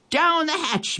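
high pirate toast call; pirate yell. A narrator's reading. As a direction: raise a rowdy pirate toast. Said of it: "Braidbeard pounded his fist on the table and yelled".

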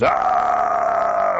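low, loud roaring "ah"; long bellow of pain from a bear; bear's scream. A narrator's reading. Bear hit in the bottom by a dart, low roaring scream. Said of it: "And Bear screams".

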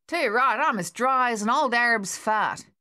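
put-on a rowdy digger cracking a crude joke in a broad Australian accent; Australian soldier.

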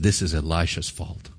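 low, muttered grudge under the breath; quiet bitter blame. Retold or imitated. King blames the prophet for the famine, low and bitter. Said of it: "his anger began to rise".

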